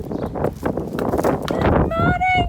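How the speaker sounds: long, drawn-out high sing-song call across the field; slow, high, loud greeting to distant animals; calling out to horses. Real, not acted. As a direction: hail the horses from afar, stretching it out.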